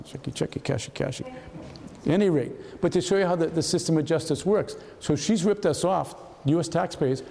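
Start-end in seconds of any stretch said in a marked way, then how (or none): 0.0-1.3 softer broken-English echo trailing off; echoed pidgin, quieter. Retold or imitated. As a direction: say it again under your breath, muttered. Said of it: "that's how I'd be talking".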